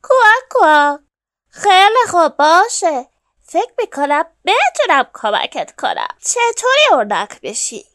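loud, animated duck teacher; emphatic storybook duck, swooping pitch; duck instructor. A narrator's reading. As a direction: lay down the rules loudly, like a bossy coach. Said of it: "اردک گفت".

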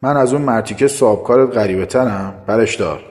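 heated, forceful command; angry, sharp tone. Acted. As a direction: snap sharply, offended and angry. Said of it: "عصبانی شدم. با لحن تندی گفتم".

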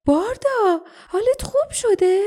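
very high-pitched, eager question; high voice of a surprised young friend; excited girl's voice. A narrator's reading. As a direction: pitch it way up, delighted and concerned. Jasmine voiced, greeting recovering friend.

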